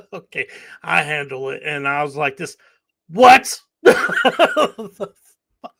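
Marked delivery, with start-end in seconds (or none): 2.3-5.7 mock disbelief. Retold disbelief at bill.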